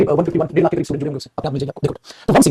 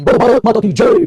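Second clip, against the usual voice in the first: raised, slow rallying chant; slogan call; 5 semitones higher, 4 dB louder, slower than usual. Shown as a demonstration. Teacher demonstrating a group slogan to students, loud chant. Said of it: "अगर हम बोल रहे हैं तो we".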